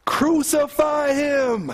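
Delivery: slow, high shout of an angry mob; crowd shouting. Acted. The crowd shouting back at Pilate, a slow high shout. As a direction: drag it out as a furious crowd cry. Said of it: "They shouted back".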